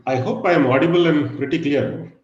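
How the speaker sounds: ordinary delivery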